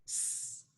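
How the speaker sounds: "S" as a voiceless sustained "sss"; isolated S sound. Shown as a demonstration. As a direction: make only the sound of "S", not its name. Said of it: "it's gonna make that S".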